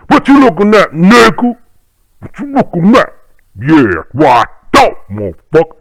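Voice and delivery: high, loud street taunt; menacing older guys. Retold or imitated. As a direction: get in the kid's face, threatening. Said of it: "older guys intimidating".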